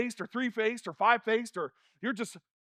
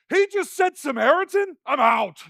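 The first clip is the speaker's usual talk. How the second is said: high, loud incredulous outburst; acted shock; 6 semitones higher, 7 dB louder than usual. Crowd member appalled at the word Samaritan, acted shouted disbelief. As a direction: shout it in mock outrage and bail. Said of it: "They would be like".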